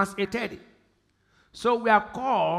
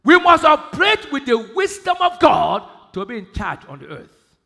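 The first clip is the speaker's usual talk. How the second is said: preaching shout; 2 semitones higher, 9 dB louder than usual. Acted. Preacher exhorting congregation.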